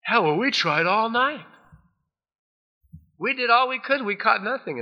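exasperated complaint. Acted. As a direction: moan about the wasted night. Fishermen complaining loudly.

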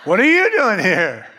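a shocked person in heaven; shocked exclaim. Acted. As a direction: blurt it out amazed. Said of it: "people are gonna be shocked".

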